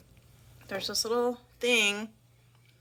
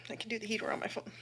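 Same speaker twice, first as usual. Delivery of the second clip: whisper; 8 dB quieter, much faster than usual. Real, not acted.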